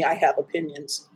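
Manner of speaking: dropped low and soft, I have opinions said under the breath; low confiding mutter of I have opinions; knowing low aside. Shown as a demonstration. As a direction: let it sink, quiet and meaningful. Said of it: "Believe me".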